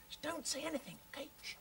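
quiet, urgent hush. Acted. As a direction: shush them and plead for silence. Hushing the puppets for test.

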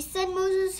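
ordinary delivery

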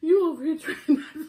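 high teasing laugh through the words; squealing with laughter. Real, not acted. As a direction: tease her while cracking up.

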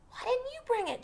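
high angry yell. Acted. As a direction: yell it accusingly. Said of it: "Jem yelled".

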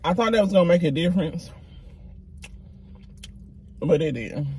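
words around a mouthful; slightly muffled mid-chew; chewing while talking. Real, not acted. Seen 0: bite at -5s, lips pressed and working between words early on.

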